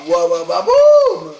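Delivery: rising to a loud high boom; excited cheering. Real, not acted. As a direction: cheer it up to a blast. Cheering a combo.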